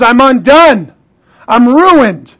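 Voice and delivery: loud, high cry of dread, stretched words; anguished Isaiah. Acted. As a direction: cry out as a man undone before God. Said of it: "Woe is me".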